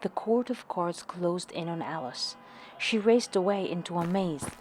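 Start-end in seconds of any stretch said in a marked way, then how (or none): none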